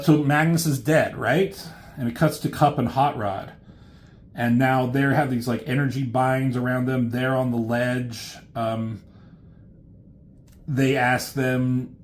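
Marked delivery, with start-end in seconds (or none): none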